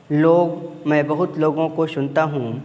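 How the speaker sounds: ordinary talk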